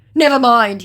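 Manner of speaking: high, loud huffy; mock sulky scammer. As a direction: toss it off as him. Voicing scammer.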